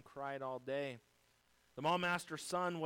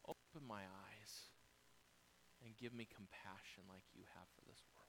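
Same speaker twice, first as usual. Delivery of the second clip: hushed prayer; 5 semitones lower, 13 dB quieter than usual. Pretend. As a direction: pray it quietly, as if alone with God. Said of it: "a great prayer to pray".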